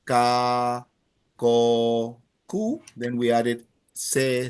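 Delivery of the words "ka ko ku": hard k sounds spelled out: ka, ko, ku; syllables ka, ko, ku. Shown as a demonstration. Teacher drilling the class on letter C, clear k sounds. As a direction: sound out ka, ko, ku slowly. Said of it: "So we went from".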